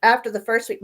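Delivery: usual speaking voice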